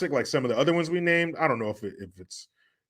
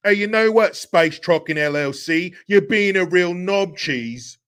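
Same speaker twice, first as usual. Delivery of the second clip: higher, louder put-on character voice; mock insult; 4 semitones higher, 5 dB louder than usual. Puts on voice to insult donor playfully.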